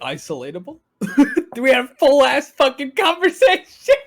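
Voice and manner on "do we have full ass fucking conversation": loud, high laughing disbelief. Real, not acted. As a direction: shout it through a laugh. Laughing disbelief at looping music.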